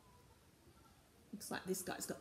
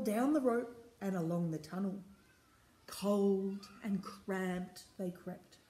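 rhythmic, emphatic storybook reading; story narration; 2 semitones higher, 6 dB louder than usual. A narrator's reading. Reader takes the crew down the rope, story narration. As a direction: chant the refrain clearly for little listeners.

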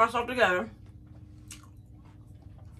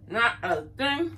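Not a thing drawn out, much slower and deliberate; slow emphasis; much slower than usual. Real, not acted. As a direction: stretch out every word of Not a thing to drive it home. Repeats line, second slowed.

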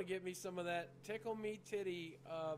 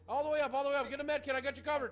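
loud, high game-panic call; raised voice calling out cover; urgent shouting; 7 semitones higher, 7 dB louder, faster than usual. Pretend. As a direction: shout directions to a teammate. Directing teammate under fire.

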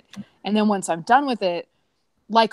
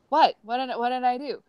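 startled confusion; 6 semitones higher than usual. Retold or imitated. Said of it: "And I'm like".

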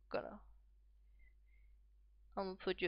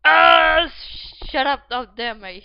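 very loud, raised-pitch outburst; real irritated yell; 4 semitones higher, 22 dB louder, slower than usual. Real, not acted. Gamer telling noisy people outside to shut up, yelling.

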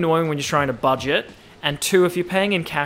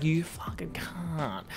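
whispered insult; 12 dB quieter, slower than usual. Shown as a demonstration. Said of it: "it's like".

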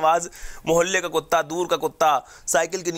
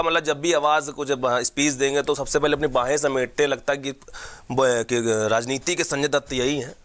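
normal talking voice

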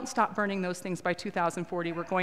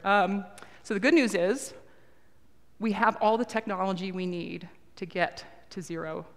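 ordinary talk

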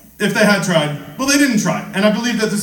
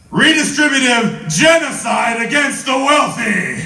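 grand proclamation; 7 semitones higher, slower than usual. Acted. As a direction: bellow it to the crowd.

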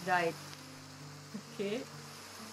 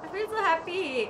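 giddy delight; 10 semitones higher, slower than usual. Real, not acted. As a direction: bubble over with joy. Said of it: "this game makes me so happy".

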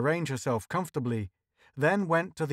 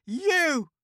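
very high accusing outburst; high, indignant burst; thundering; 4 dB louder than usual. A narrator's reading. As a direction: thunder it, pitched way up. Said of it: "she thundered".

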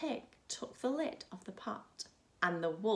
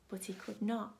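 usual speaking voice

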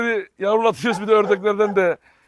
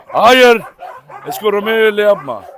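stern shout; 2 semitones higher, 9 dB louder than usual. Real, not acted. Scolding dog.

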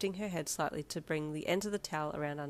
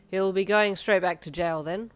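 police officer; 8 dB louder than usual. A narrator's reading. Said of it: "one police officer said".

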